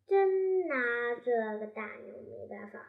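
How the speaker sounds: ordinary delivery, nothing marked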